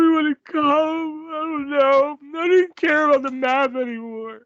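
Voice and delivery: hammed-up high-pitched heartbroken wail, fading at the end; mock-sobbing wrestler falling apart; Matt Hardy. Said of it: "I find out Lita cheated on me".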